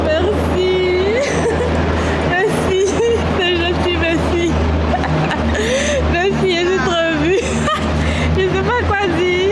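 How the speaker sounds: choked up, laughing through tears; overwhelmed, near tears, thanking; emotional crying laugh. Real, not acted. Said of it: "Je veux pleurer".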